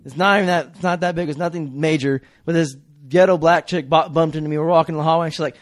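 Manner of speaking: ordinary delivery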